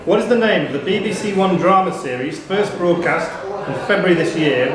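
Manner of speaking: ordinary talk, nothing marked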